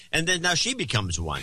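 ordinary talk, nothing marked

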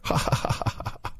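scripted chuckle; staged ha-ha laugh; acted laughter. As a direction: perform a "natural" laugh on cue. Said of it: "Natural laughter".

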